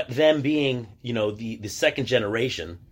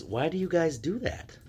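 lightly mimicked bafflement, higher voice; softer, higher quoted question; puzzled questioning; 7 semitones higher, 5 dB quieter than usual. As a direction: imitate a baffled questioner, pitch climbing at the end. Acting out puzzled questioners in Mexico.

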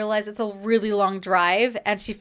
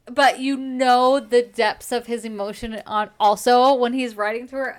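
excited gushing; 3 semitones higher, 6 dB louder than usual. Real, not acted. Fangirling about the hero.

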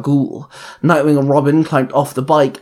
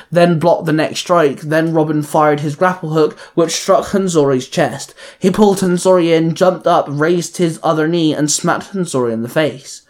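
plain reading voice

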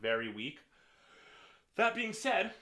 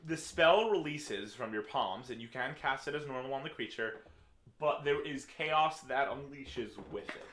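slow dramatic scene setting; dramatic narration; 4 dB louder than usual. Acted. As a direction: build the blaze slowly. DM narrates the fire.

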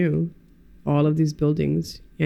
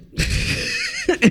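embarrassed laugh. Real, not acted. Embarrassed laugh after confession.

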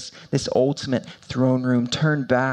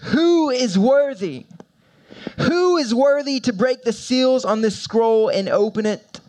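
angel's loud call; 9 semitones higher than usual. Acted. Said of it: "who shouted with a loud voice".

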